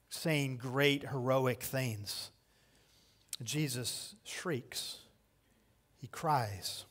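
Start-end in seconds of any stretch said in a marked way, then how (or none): 3.4-6.8 slow whisper of "Jesus shrieks"; dramatic whisper. Acted. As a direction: drop to a hushed, slow whisper. Dramatic contrast in sermon.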